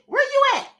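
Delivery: pry like a nosy friend on the phone; nosy caller. Retold or imitated. Caller checks where she is, mock nosy caller. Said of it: "they'll call you and say".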